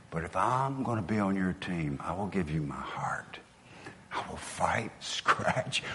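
low, soft and earnest; hushed vow. Real, not acted. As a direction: drop to a near-whisper for a solemn promise.

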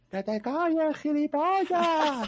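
imitated distant heckler, high and drawn out; voz a lo lejos. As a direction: holler back from far down the street, mock yell. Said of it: "se escucha uno a lo lejos". (grito lejano imitado, agudo y alargado)

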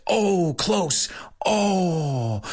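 high, drawn oh close; mock disappointment. Shown as a demonstration. Host demoing the American groan of disappointment. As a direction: sigh over a near miss.

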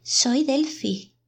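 high, drawn-out wail; plaintive. A narrator's reading. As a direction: plead slowly in a high, mournful voice. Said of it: "dice plañideramente". (tono plañidero)

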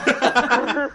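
a high laughing cry of her name; high laughing call of Candela; gleeful shout. Real, not acted. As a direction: crow over the caught friend. Prank revealed to Candela.

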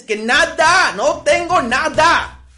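shouting "Que nada, no tengo nada", high, loud and slow on "tengo"; exasperated shout. Acted. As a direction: blow up, sick of being asked. Fed-up reply to the pestering. (grito exasperado: "no tengo nada" alto, fuerte y lento)

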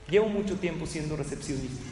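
ordinary talk, nothing marked

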